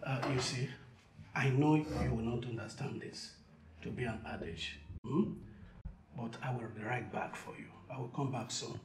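quiet and gentle; soft reassuring. Acted. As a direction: soothe a scared child softly. Soothing child softly.

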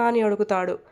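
ordinary delivery

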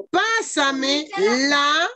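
pásame la dragged out very slowly; slow dictation. Shown as a demonstration. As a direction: drag it out for the class to copy. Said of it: "teacher dictating word by word".

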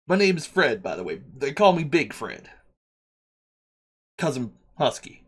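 lightly voiced New York worker introducing himself; a hint of a local subway guy; Big Fred, friendly transit worker. Game master plays Big Fred.

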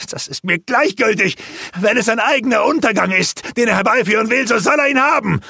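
enraged warrior shouting defiance at the sorcerer; furious shout. Acted. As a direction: let the rage boil over into a yell. Granok rages at Rurak.

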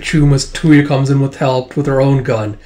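ordinary talk, nothing marked

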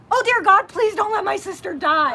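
high, loud panicked prayer screamed out; sister's screamed prayer. Retold or imitated. Sister screams over choking Debbie, a loud panicked prayer. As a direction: shriek it, begging God. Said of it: "my sister screams".

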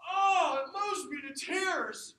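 loud, high, drawn-out hammed-up gushing; gushing mock reaction. Fan gushing over a celebrity's short clip, mock-moved. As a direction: wail it slowly, overdoing the adoration.

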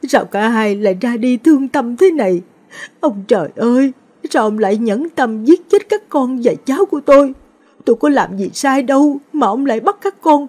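keen over the dead, voice pitched high and raw; wailing lament. A narrator's reading. Grandmother wailing over coffins of son and grandson, high anguished wail. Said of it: "bà gào thét bên quan tài".

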